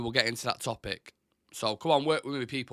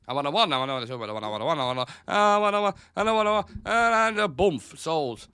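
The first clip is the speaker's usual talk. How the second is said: rapid auctioneer chant, rising to a loud bump; mock auctioneer calling for bids; playful auctioneer rattling off bids, then sold; auctioneer patter; 3 semitones higher, 5 dB louder than usual. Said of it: "I feel like I'm doing an auction".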